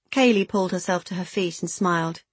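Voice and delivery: ordinary delivery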